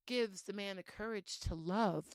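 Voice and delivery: normal talking voice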